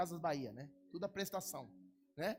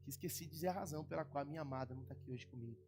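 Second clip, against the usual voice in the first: lower, softer, heartfelt plea; tender, sad request; 5 semitones lower, 4 dB quieter than usual. Real, not acted. Pastor asking the church to pray for his wife, soft and sad. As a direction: speak softly of missing your wife.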